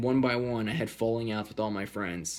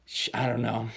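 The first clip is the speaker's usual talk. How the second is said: normal talking voice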